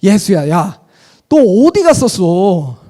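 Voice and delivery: a parent's mock anger at a missing child; mock angry parent. Shown as a demonstration. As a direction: scold the lost son, half acted. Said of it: "안도감 백 퍼센트를 분노 백 퍼센트로".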